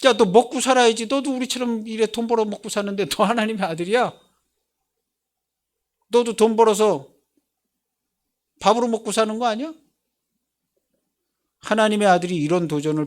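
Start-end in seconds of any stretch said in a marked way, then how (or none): 0.0-9.7 taunting challenge. Retold or imitated. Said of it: "수많은 사람들이 도전해요".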